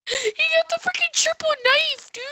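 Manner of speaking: high, incredulous exclamation; excited amazement. Real, not acted. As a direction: marvel at it in a high voice. Still amazed at triple knife.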